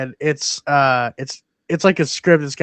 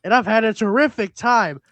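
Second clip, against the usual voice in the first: higher, louder mock Scorsese voice; Scorsese impression; 5 semitones higher, 4 dB louder than usual. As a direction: rattle it off like an excited old director. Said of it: "what he's saying".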